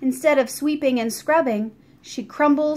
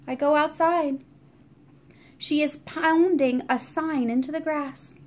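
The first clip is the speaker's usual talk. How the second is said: plain reading voice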